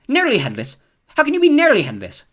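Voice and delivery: put-on high, loud boyish disbelief; Seamus incredulous. A narrator's reading. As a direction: demand it in disbelief. Seamus interrupts.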